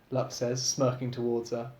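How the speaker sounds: ordinary delivery, nothing marked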